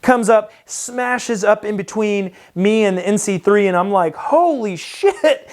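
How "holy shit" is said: high, drawn-out mock shock; shocked exclamation. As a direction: stretch it out high with a laugh close behind. Retelling his shock at the crash.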